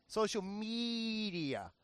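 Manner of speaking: slow, soft Miami accent. Shown as a demonstration. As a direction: drawl it out Miami style. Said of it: "That's how I say it in my Miami voice".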